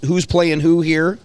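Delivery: usual speaking voice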